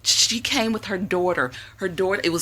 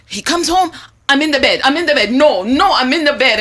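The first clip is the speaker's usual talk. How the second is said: high, loud hammed-up Jamaican lilt; Jamaican accent; 8 semitones higher, 9 dB louder than usual. Retelling the Jamaican caller's husband story, hammed-up Jamaican accent. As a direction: play the laughing island lady, way up high. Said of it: "You're not bad with Jamaican Creole".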